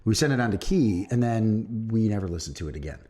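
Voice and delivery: usual speaking voice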